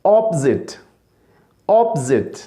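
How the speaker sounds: "ऑपोजिट" as op-zit, falling; correct pronunciation. Shown as a demonstration. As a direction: drill "ऑपोजिट" in two syllables, pausing between. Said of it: "सही तलफ़ुज़ क्या है?".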